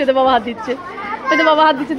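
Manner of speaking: normal talking voice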